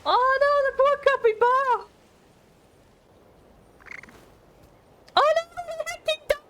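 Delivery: very high-pitched, drawn-in cry of dismay; dismayed squeal. Real, not acted. Streamer reacting to the poor capybara in game, high dismayed squeal. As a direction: squeal in sympathy for a poor animal.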